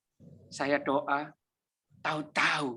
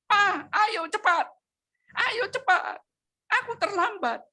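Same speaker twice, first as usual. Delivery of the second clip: retold frantic yelling; 4 dB louder than usual. Wife yelling at the pastor to hurry, high frantic cry. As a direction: shriek at him to hurry up, pitched way up. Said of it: "datanglah istrinya teriak-teriak".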